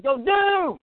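high, loud call down the street; yelling at the door. Retold or imitated. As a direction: shout it so he hears inside. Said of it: "So I'm yelling at the door like".

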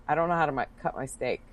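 imitating a friend's quiet, sheepish admission; embarrassed confession. Friend confessing at dinner that she can't cut steak, sheepishly. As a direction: drop the voice as if ashamed to admit it. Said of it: "I'm really embarrassed to say this".

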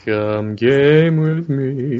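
slow, lingering come-on; mock seductive. Teasing Norma's plug, slow mock seduction. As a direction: stretch it out like a lover's invitation.

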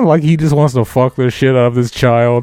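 normal talking voice

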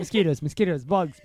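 ordinary delivery

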